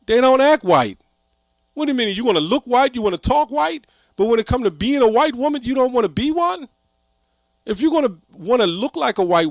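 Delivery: high, indignant rhetorical questions; incredulous rant. Real, not acted. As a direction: fire the questions up high in disbelief. Ranting rhetorical questions to women.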